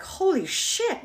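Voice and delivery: high, slow stunned gasp of awe; amazed. Retold or imitated. Typing her fifteen ideas, stunned and slow. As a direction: let the awe stretch the words.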